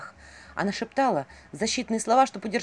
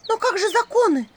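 high, loud outraged question; indignant protest; 14 semitones higher, 6 dB louder than usual. Acted. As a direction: cry out in protest. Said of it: "возмутилась Василиса".

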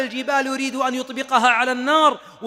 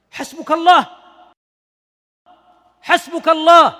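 full-force preaching; 6 semitones higher, 10 dB louder than usual. Acted. Preacher urging his listeners to trust God, full-force preaching. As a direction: thunder it out over the crowd.

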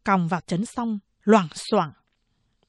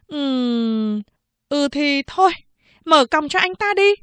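high female voice rising louder; waver, then give the order; hesitant then decisive policewoman; 6 semitones higher, 4 dB louder than usual. Acted. Policewoman relents and orders the cuffs off, high voice.

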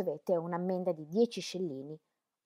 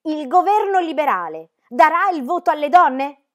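high, very loud shout; shrieked question; 9 semitones higher, 16 dB louder than usual. A narrator's reading. Woman on a chair shouting over feet and chatter, shrill yell. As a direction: yell it over a crowd's noise. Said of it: "strillò al di sopra del rumore".

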